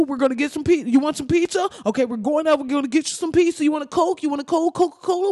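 high, anxious coaxing; pleading negotiator. Acted. Police coaxing an armed man to drop the gun, pleading.

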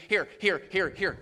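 loud, insistent mock street evangelist; mock pushy tract pushing. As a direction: shove it at them like handing out tracts. Mocking pushy tract handing.

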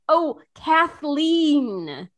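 slow, confused repeating of the name; drawn-out puzzled "Oh, Kathleen"; slow puzzled echo. Retold or imitated. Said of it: "I was like".

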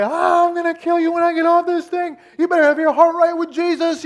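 high, loud comic screamed threat; retold full-voiced, high-pitched tirade; screaming the whole way. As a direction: yell it as if stuck on a roller coaster. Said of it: "I was screaming the whole way".

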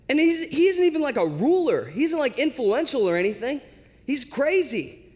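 hammed-up objection, stretching the final verdict; exasperated protest. Disciples keep protesting to Jesus.

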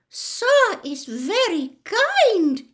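very high, slow, loud elf voice; tearful grateful squeak. A narrator's reading. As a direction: squeak it slowly, overwhelmed with gratitude. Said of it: "he squeaked".

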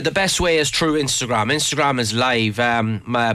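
normal talking voice

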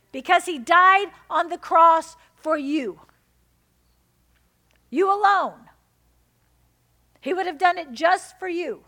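loud, high, fervent preaching; preaching at full force. Acted. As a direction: drive it home to the congregation.

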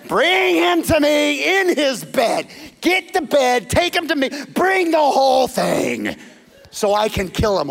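high, loud, slow royal order; commanding shout. Retold or imitated. Saul ordering troops to carry David in his bed, shouting. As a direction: bellow the command down at them.

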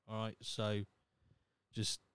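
usual speaking voice

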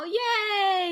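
high, loud happy whoop; excited cheer. Real, not acted. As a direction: cheer like you just won. Cheering the new social pages.

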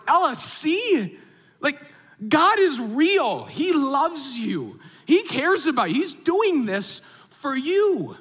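high, slow, drawn-out emphatic joy; overwhelmed, emotional. Retold or imitated. Dad to daughter after answered prayer, overwhelmed and emotional. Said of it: "I'm almost crying".

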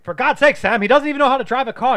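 high, loud, exasperated doctor; Loomis impression. Quoting the exasperated doctor to his co-host, loud. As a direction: blurt it out in outrage. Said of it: "he's like".

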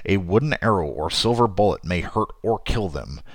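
ordinary delivery

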